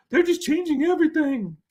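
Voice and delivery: high, whiny mock complaint; mock outrage. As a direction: imitate someone upset that tradition is changing. Mimics upset people's complaint.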